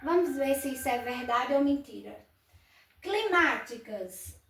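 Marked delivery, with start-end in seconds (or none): 3.0-4.4 Climáticas said slowly, leaning on the má; slow it down and hit má in Climáticas; stressed syllable shown. Shown as a demonstration. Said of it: "a sílaba tônica é má". (Climáticas devagar, com ênfase na sílaba má)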